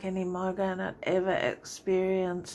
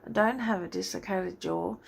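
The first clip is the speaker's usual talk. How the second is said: ordinary talk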